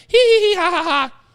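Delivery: squeaky hee hee ha ha cackle; high mocking giggle. Host cackling high at his own quip to the chat. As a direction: cackle high and giddy at your own joke.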